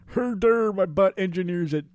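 high pitched silly voice; goofy voice. Pretend. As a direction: squeak it like a dimwit.

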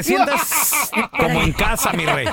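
fuerte y rápido, voz de locutor; anuncio de locutor radial. Acted. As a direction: vende la siguiente sección a la audiencia. (locutor de radio anunciando fuerte)

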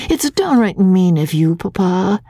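put-on high girlish tantrum; indignant, foot-stamping daughter; petulant cry. A narrator's reading. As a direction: complain hotly, pitched high, at Papa. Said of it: "cried Magnolia, and stamped her foot".

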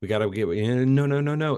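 mock panic, voice climbing into the repeated refusals; imitated panic. Reviewer retells her dialogue as the penny drops, mock panic.

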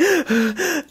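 sharp, high gasping breaths; quick shocked inhales, acted out; heavy quick gasps. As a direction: imitate panting from cold shock. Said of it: "breathing, like, so heavy and so quick".

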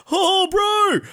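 very high, loud mock bro. Mocking the footballer in the interview, very high bro. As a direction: squeak it out like a mocked bro. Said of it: "it's like".

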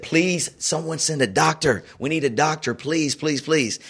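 high-pitched re-enacted cry for help; urgent pleading. Coach calling for a doctor, urgent retold plea.

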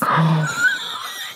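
sharp high gasp of shock. Real, not acted. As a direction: gasp in real disbelief. Shocked by news.

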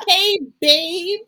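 "Hey, babe" high and loud, punched B's; emphasis on the B's. Retold or imitated. Imitating a man claiming his girl, "babe" with hard-hit B's. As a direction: imitate him hitting both B's in "babe". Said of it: "He put the emphasis on them B's".

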